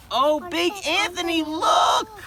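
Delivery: high, loud alarmed cry; a startled sister pointing in shock; alarmed nun. Acted.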